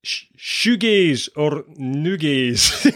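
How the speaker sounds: slow Scottish accent, Scottish rolled vowels on shoegaze. Shown as a demonstration. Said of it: "It might be better in a Scottish accent".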